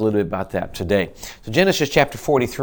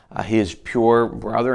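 usual speaking voice

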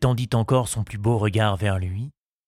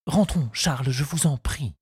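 higher, mildly agitated plea; distraught; 5 semitones higher than usual. A narrator's reading. Henriette begs Charles to go back, distraught. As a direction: plead with understated panic. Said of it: "Elle semblait affolée".

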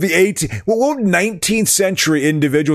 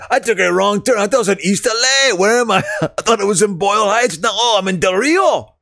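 higher-pitched East LA homeboy voice; mock Chicano accent; 6 semitones higher than usual. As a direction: put on a lively Chicano accent. Plays lost East LA homeboy.

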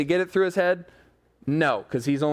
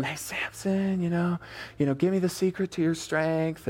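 seductive coaxing; 4 dB quieter than usual. Retold or imitated. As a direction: coax him quietly, playing the temptress. Delilah coaxing Samson on passionate night.

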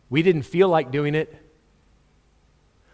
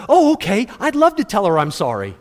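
put-on eager little boy; 3 semitones higher, 3 dB louder than usual. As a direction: pretend to be delighted to apologise. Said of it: "Have you ever met the little boy that went".

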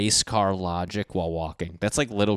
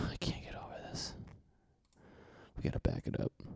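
unvoiced stunned whisper; 17 dB quieter, faster than usual. Real, not acted. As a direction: breathe it out in shock. Whispering in disbelief.

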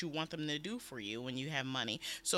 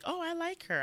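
delighted approval. Retold or imitated. Acting out earlier liking of senator.

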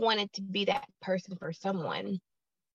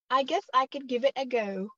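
higher-pitched try at a British accent; British accent attempt; 5 semitones higher than usual. Shown as a demonstration. Guest trying a British accent on the host's request, mock-British. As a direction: put on a tentative British accent. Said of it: "can you do a British accent".

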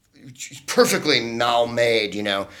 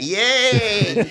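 cheering crowd, much higher, thrown up; mock crowd cheer; 11 semitones higher than usual. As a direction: imitate the whole room cheering him on. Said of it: "they went".

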